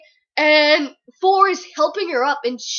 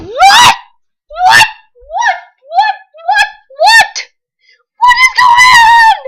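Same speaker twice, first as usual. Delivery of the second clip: high, loud shrieking whats, half laughing; shocked disbelief; 12 dB louder, much slower than usual. Retold or imitated. Reader shrieking in shock as the rung snaps. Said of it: "And I'm just like".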